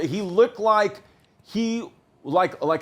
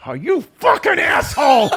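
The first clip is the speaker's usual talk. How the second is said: imitating coach yelling; 9 semitones higher, 5 dB louder than usual. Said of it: "he was like".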